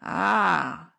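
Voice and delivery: loud sudden realizing ah; a burst of realization; the fence spotting the open window; exclamation. Acted. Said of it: "he exclaimed".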